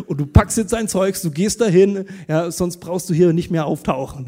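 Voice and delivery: loud, firm ultimatum; stern orders. Retold or imitated. Pastor sends friend to the dentist: stern loud ultimatum. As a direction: lay down the law to him. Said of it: "Und ich zu ihm gesagt".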